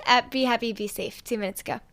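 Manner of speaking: ordinary delivery, nothing marked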